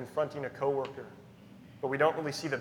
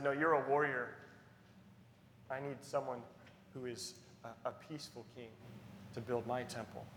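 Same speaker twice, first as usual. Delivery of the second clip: much softer, lower; quiet divine voice; 4 semitones lower, 12 dB quieter than usual. Acted. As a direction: speak it hushed as God's reply. God's words to David.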